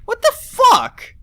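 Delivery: very high, loud, slow disbelieving shriek; outraged shriek. Real, not acted. Reacts to insult again.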